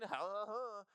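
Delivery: high-pitched fake stoner laugh; mock laugh. Imitating stoners high-fiving and laughing, mock laugh. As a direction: put on a mocking, high "ha ha ha".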